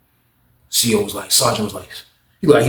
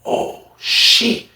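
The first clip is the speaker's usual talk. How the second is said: very high breathy gasp of surprise; whispered shock. Retold or imitated. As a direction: gasp it out, stunned. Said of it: "I'm like".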